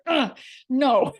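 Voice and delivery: high, pushed ugh of disgust; retold irritation, half laughing; exasperated mock grunt; annoyed groan. Irritated while stuck behind truck.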